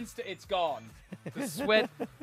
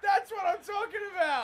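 excited cheer. Real, not acted. Grand final win celebration.

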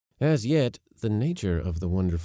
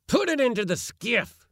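high, forceful roar; roared order; 3 dB louder than usual. A narrator's reading. Uncle ordering his helper to load the box, roaring. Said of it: "roared my uncle to Yorpy".

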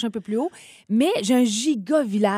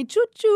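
high-pitched toy train choo-choo; train whistle imitation; 13 semitones higher, 4 dB louder than usual. Describing her Christmas village's little train, imitated high-pitched whistle.

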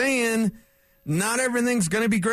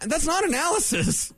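ordinary talk, nothing marked

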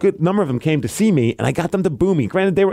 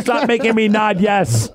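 laughing mock plea; 7 semitones higher, slower than usual. Joking as neck-brace victim.

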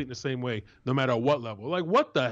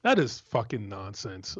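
ordinary talk

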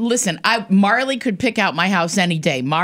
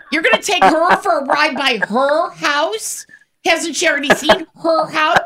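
high-pitched incredulous questioning; retold disbelief; 8 semitones higher than usual. Sister retells questioning Mom's house ride, high and incredulous. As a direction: replay your disbelief, pitched well up.

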